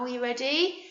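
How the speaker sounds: ordinary talk, nothing marked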